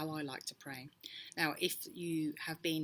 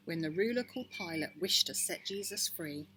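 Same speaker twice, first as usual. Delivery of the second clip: ordinary delivery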